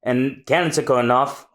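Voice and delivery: ordinary talk, nothing marked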